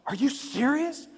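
high, disbelieving squeal; incredulous. Retold or imitated. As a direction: gasp it out in mock disbelief. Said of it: "they've said this question".

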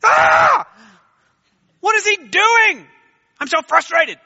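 a high, loud, exasperated outburst; exasperated God. Pretend. Preacher voicing the frustrated God who never was, shouting. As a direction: blow up in pretend frustration. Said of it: "God never looks down and says".

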